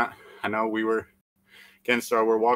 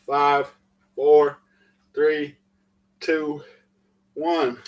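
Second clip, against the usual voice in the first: loud countdown; 4 semitones higher, 5 dB louder, much slower than usual. Real, not acted. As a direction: call each number out loudly. Coach counting down the last reps.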